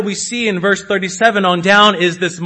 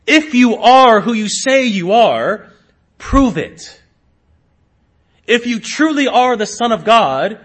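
higher, louder taunting demand; challenging; 5 semitones higher, 5 dB louder than usual. Acted. Preacher voices the thief challenging Christ on the cross, challenging. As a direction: throw it down as a pointed dare. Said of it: "he challenges Christ".

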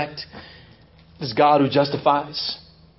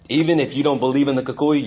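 ordinary talk

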